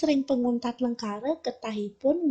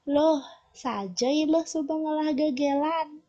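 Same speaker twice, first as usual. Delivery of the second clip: "Loh, saja ilah subengelah gegelan" pitched higher with a questioning lift; question intonation; 4 semitones higher than usual. Shown as a demonstration. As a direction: ask it as a real surprised question.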